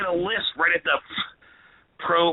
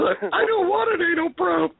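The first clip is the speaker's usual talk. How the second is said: laughing; 13 semitones higher than usual. Real, not acted. Mocking S2's line while laughing.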